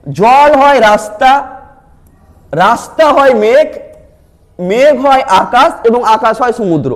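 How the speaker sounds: very slow, word by word, like reading a puzzle to class; slow careful reading. Shown as a demonstration. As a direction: spell out each link slowly for the students. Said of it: "একটু যদি আমরা এটাকে একটু পড়ি ডিটেলসে".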